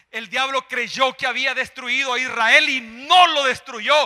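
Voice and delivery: loud, high, shouted sermon; full-force preaching. Real, not acted. Preacher telling the congregation God's blessing never ends, full-force preaching. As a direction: drive each declaration home at a shout.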